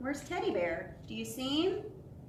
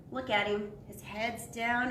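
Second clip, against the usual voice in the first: plain reading voice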